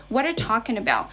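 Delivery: "What are you talking about" run quickly together; "What are you" reduced to "whaddaya" before a stressed "talking"; native-style linking. Shown as a demonstration. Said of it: "when they say English like this".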